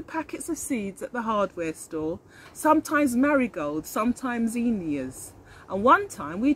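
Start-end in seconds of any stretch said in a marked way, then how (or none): none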